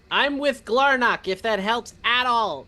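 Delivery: calling down. Acted. Player tells hidden cultists of an ally, calling down.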